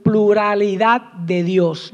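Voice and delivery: ordinary talk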